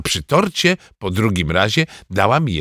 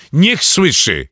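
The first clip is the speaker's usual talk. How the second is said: defiant, raised shout; shouting dad; 5 semitones higher, 5 dB louder, slower than usual. Acted. Father shouting back at mother in defiance. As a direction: let her hear it, loudly. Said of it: "krzyknął tata".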